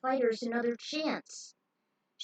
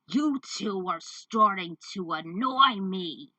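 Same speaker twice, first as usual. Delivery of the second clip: slow, lower, louder menace; menacing villain; 3 semitones lower, 5 dB louder, slower than usual. Acted. Villain warning the two boys, slow low menace. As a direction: let the threat drip slowly.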